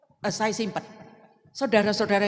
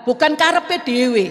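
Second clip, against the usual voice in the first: emphatic preaching; 7 semitones higher, 7 dB louder than usual. Acted. Preacher's emphatic point on prayer.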